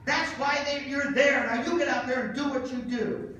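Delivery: high mock wife voice, firm pep talk; lightly voiced wife, encouraging; imitating wife. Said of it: "She goes".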